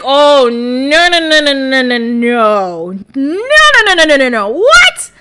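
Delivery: hammed-up outrage at a TV couple; horrified disbelief. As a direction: pile on the no's, then crack into a squeal. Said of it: "And I was like".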